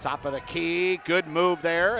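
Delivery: ordinary delivery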